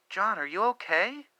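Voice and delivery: a wife in bed calling to her husband in the kitchen, lightly voiced. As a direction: call out gently like a half-awake wife. Wife calls from the bedroom.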